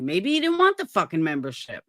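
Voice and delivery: ordinary delivery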